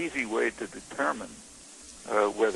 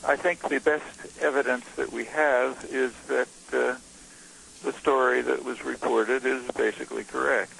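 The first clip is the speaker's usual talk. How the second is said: deliberate, raised speaking volume; speaking louder; 2 semitones higher, 3 dB louder than usual. Real, not acted. Guest repeating his answer for a host who couldn't hear, speaking louder. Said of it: "I'll try to spo-, speak louder".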